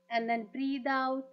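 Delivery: very slow, drawn-out meditation voice; guided meditation. Acted. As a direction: lead the breathing slowly, stretching the words. Leads a breathing meditation. (धीमी, खिंची हुई ध्यान वाली आवाज़)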